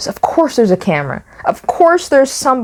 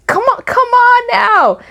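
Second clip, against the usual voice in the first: exasperated; 14 semitones higher than usual. Real, not acted. Exasperated rant at the tourist.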